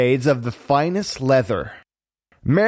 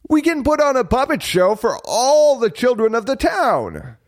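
high-pitched excited planning; thrilled Geppetto; 10 semitones higher than usual. A narrator's reading. Geppetto planning a show for the town, thrilled. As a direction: let the idea soar high with delight.

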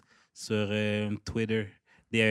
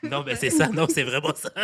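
laughing; 7 semitones higher, 5 dB louder than usual. Real, not acted. Laughing agreement.

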